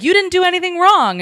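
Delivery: high, emphatic insistence; insistent Jim. Retold or imitated. Jim insisting to Spock, pitched high. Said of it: "And Jim is like".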